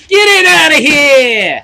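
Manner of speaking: high, loud, slow drawn-out holler; put-on shout. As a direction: bellow it like a character.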